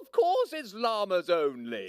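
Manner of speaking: high, loud, slow fussy llama; officious llama. Acted. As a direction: lay down the rules pompously. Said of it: "said the llama officiously".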